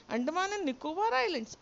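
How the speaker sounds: high wailing complaint. A narrator's reading. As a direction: wail it out like a sulking kid. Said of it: "I wailed".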